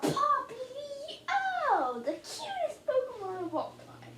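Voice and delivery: high, soft cooing voice; cutesy cooing. Real, not acted. Owner teasing Poppy about being cute, cooing. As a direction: slide up high and coo it, drawn out.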